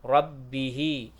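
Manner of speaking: Rabbihi with a heavy, thick r. Shown as a demonstration. Teacher models Rabbihi for students with a thick r. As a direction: say Rabbihi slowly with a full tafkhim r. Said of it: "yang bisa huruf ro-nya yang sudah tebal".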